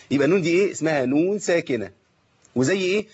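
ordinary delivery

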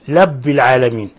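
لب العالمين with l replacing the opening r; mispronounced rabb, said as لب العالمين; ra turned lam. Shown as a demonstration. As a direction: demonstrate the slip لب العالمين, l for r. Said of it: "تقريب راء ربي إلى اللام".

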